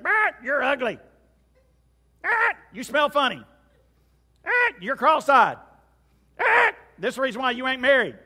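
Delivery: high, loud squawking parrot jeers; insulting parrot. Acted. Parrot hurling insults at its owner, loud high squawk. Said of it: "it continued to say insults to her".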